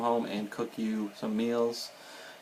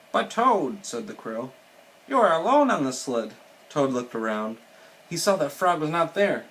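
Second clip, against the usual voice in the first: animated storybook reading, louder for the dialogue; reading to children; 5 semitones higher, 6 dB louder than usual. A narrator's reading. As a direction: read it lively, lifting the characters' lines.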